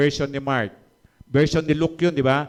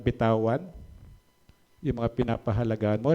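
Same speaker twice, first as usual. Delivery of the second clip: ordinary talk, nothing marked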